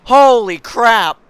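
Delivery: slow, loud, high stunned cry; drawn-out shocked holy crap; stunned exclamation. Real, not acted. Wreck unfolding.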